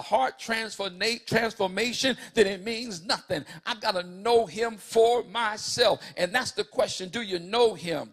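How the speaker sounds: impassioned preaching. Acted. Preacher asking the church do you know him, impassioned. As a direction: lift the church with the blood of Jesus. Preaching climax over music.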